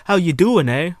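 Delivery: loud, high mock-Canadian "eh". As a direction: hammer out a cartoon Canadian greeting. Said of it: "as where the stereotype says it".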